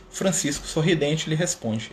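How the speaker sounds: normal talking voice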